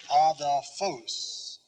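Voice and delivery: ADELPHOS as ah-thel-FOHS, very slow; stress on final syllable. Shown as a demonstration. Teacher reminding students of the pronunciation, adelphos slowly stressed on the end. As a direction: stretch "adelphos" and lean on the final "phos". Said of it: "the pronunciation of that word".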